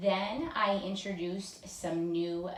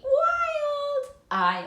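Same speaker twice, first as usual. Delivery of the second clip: very high, loud, drawn-out "wild"; wild playful call; 10 dB louder than usual. Shown as a demonstration. Teacher leading a phonics drill, wild playful call. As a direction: let out a high, stretched whoop on the word.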